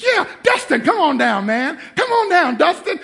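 high, loud game-show call; excited call. Retold or imitated. Dad calling his eighteen-year-old son down, high and loud. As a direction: announce him like a prize winner. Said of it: "I was like".

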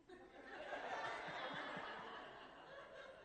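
a high breathy burst of laughter; airy laugh. Real, not acted. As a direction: laugh it off at the punchline. Laughing at own joke.

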